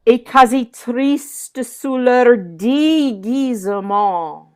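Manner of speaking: slow French verse, long first syllable on déguisement; declaimed line leaning into triste and the opening of déguisement; insistance. Shown as a demonstration. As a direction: stretch the "dé" of déguisement. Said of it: "what a great insistance".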